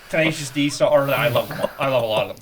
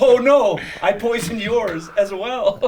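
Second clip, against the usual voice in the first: laughing then slow smug reveal; laugh, then drop the smug twist slowly; laughing mock reveal; 6 semitones higher, much slower than usual. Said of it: "he's like".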